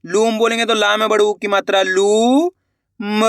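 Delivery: ordinary talk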